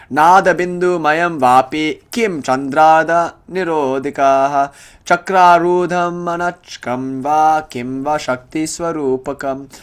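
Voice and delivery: measured chant of scripture; verse recitation. Shown as a demonstration. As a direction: intone the verse slowly.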